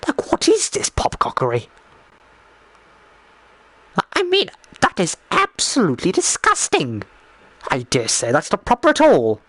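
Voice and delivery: mock-appalled old-fashioned indignation; gobsmacked. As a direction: play scandalised disbelief, pitch leaping up. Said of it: "I was absolutely gobsmacked".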